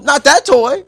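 put-on loud, high kid's protest; child refusing mom, high and loud; insistent child. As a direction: whine it like a stubborn kid.

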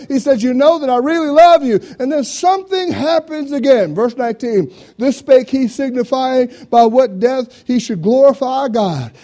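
high, loud, relentless preacher shout; preaching at full force. Acted. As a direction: shout it to the back pew. Sermon climax, shouted preaching.

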